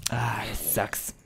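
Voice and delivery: slow, whispered letdown; dejected. Real, not acted. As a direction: breathe it out in a whisper. Dejected at dead villagers.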